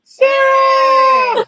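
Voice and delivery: high excited shout of a name. Real, not acted. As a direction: yell the name with delight. Surprise guest greeted.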